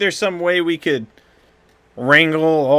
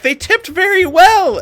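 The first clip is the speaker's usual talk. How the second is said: high, slow, cheery character voice; a happy hat counting tips; oblivious bartender; 7 dB louder, slower than usual. Acted. Sebastian the hat bartender cheerfully pleased about tips.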